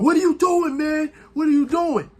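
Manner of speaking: high, exasperated disbelief; Jay exasperated. Retold or imitated. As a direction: imitate a stunned brother yelling at him. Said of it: "here comes Jay talking about".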